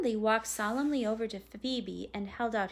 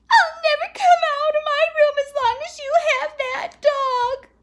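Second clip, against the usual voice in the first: high, loud sobbing wail; bawling; 16 dB louder than usual. A narrator's reading. Girl locked in her room over the dog, bawling. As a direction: bawl it out like an inconsolable child. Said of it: "was snuffle and bawl and say".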